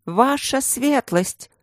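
high anxious call, drawn out. A narrator's reading. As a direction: call out urgently, stretching it. Guards calling out for duke.

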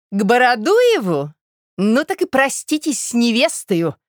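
high, loud, drawn-out challenge; sharp retort. A narrator's reading. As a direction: throw his words back at him sharply. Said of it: "резко сказала Настасья Петровна".

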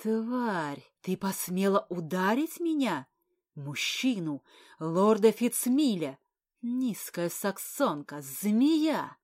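loud, slow furious insult. Acted. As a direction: spit out each insult with rage. Он затрясся от ярости.

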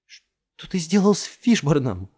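trembling voice. A narrator's reading. Jess to Jamil, voice trembling with fear. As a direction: voice breaking with fear. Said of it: "Голос её дрогнул".

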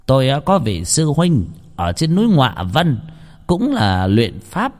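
a sage revealing grim secrets; old master explains. Acted. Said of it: "thầy Phong mới bảo".

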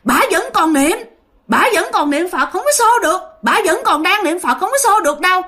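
high ghost holding the others back; another ghost voice. Acted. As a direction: insist firmly she is still praying. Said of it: "một giọng nói khác lại nói rằng".

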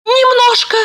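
high-pitched little answer; squeaky, modest reply; small meek heroine. A narrator's reading. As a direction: admit it in a tiny high voice. Tired heroine answers the boss.

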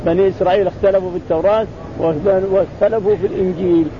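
usual speaking voice

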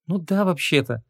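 high, coy little admission; sweet, ingratiating woman. A narrator's reading. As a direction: admit it sweetly with a smile. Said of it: "умильно улыбнулась Ванесса". (умильно, высоким женским голосом)

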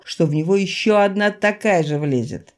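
ordinary delivery

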